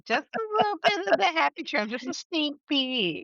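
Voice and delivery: high, teasing little voice; high-pitched, giddy teasing; playful squeal. Real, not acted. Playful teasing about drawn body.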